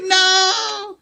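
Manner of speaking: high, loud drawn-out cry; hammed-up anguished shout; mock dismayed wail. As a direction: wail it like a thwarted fan. Retold fan's dismay at being moved on.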